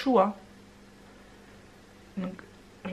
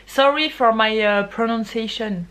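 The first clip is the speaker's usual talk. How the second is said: loud apology; 6 dB louder than usual. Real, not acted. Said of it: "Désolée pour ma prononciation".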